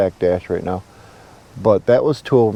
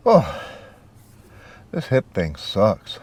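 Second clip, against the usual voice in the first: ordinary talk, nothing marked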